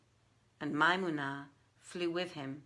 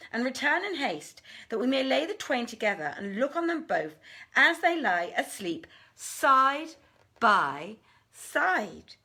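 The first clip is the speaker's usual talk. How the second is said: much louder, raised, furious jinniya; furious threat; 5 semitones higher, 6 dB louder than usual. Acted. Maymunah commands Danash to fetch his mistress, raging. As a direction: threaten him with fire and fury.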